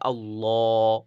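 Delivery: আল্লাহ with a full, heavy ল; heavy Allah. Shown as a demonstration. A Quran teacher to students, modelling a heavy আল্লাহ. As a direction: round out the ল in আল্লাহ. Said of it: "এইভাবে করে".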